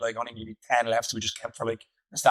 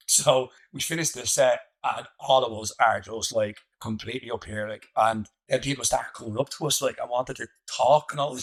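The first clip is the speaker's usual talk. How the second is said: usual speaking voice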